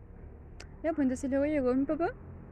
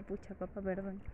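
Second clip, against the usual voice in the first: much softer, deflated sorry; sheepish apology; 4 semitones lower, 10 dB quieter than usual. Retold or imitated. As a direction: mumble it like a scolded kid. Daughter apologizing to disappointed dad.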